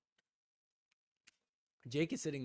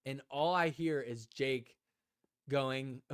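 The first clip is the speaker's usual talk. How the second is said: ordinary delivery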